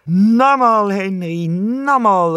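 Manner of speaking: excited calling wombat. A narrator's reading. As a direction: cheer him on to jump again. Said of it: "ruft der Wombat".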